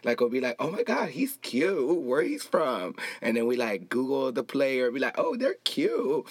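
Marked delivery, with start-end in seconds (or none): 0.6-3.0 high, swooping crush reaction; playful, sing-song swooning; gushing. Acted. Acting out gushing over players. 5.2-6.3 high, drawn-out swoon; playful high crush reaction; gushing. Acted. Acting out gushing over players.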